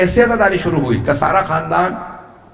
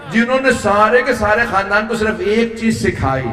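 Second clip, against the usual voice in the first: ordinary delivery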